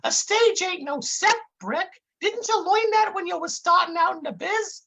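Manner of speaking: high, dim-witted sidekick explaining things; dopey Dummerton voice. Acted. Dummerton lecturing his partner Brick onstage, high dopey voice.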